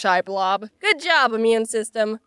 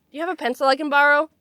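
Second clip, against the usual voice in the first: fast eager asking; 4 semitones higher, much faster than usual. Acted. Acted classmate asking.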